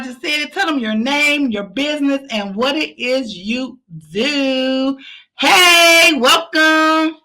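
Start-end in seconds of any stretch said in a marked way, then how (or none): 5.4-7.2 loud welcome. Real, not acted. Host welcomes guest loudly.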